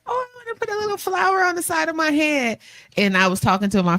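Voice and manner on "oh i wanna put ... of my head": high, soft girly lilt, playing dainty; a high girly voice, softer, imitating herself; high cutesy voice. Teacher recalling her morning, in a high dainty voice. Mimicking own cutesy thought.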